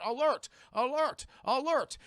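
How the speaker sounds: high, loud repeated warning; mock alarm, evenly repeated; computer alarm. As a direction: imitate an automated alert.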